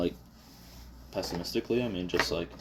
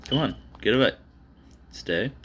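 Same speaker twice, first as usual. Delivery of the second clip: normal talking voice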